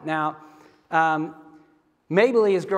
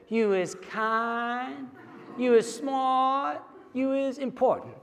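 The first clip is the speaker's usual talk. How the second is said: put-on high, slow little-girl voice; little girl repeating after her nanny, high and slow; 7 semitones higher, 4 dB quieter, much slower than usual. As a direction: echo it back like a three-year-old. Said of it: "this little girl with her southern accent has to say it along with her".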